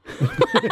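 a quick high laugh. Real, not acted. Host laughing at own Jamie Lee Curtis jab, high laugh. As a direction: let a high laugh escape.

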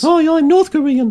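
very high, silly put-on voice; mock North Korean. Sample from a joke accent ad, mock North Korean in high voice. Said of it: "North Koreans".

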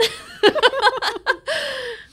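real high laugh; laughing hard; high burst of laughter. Real, not acted. Laughing at own joke.

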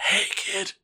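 put-on a toothless fey leering at the kid; creepy Fred voice. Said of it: "And I was like".

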